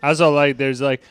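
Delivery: ordinary talk, nothing marked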